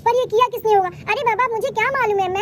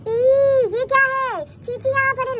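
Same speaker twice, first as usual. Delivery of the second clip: higher-pitched, appalled discovery; shocked disgust; 4 semitones higher, 3 dB louder than usual. Acted. Mother finds mess in the bed, appalled. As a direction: recoil in dismay at the mess.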